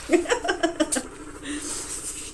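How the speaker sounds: high, breathy real chuckle; soft laugh. Real, not acted. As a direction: laugh at the silly farmer. Narrator laughs at joke.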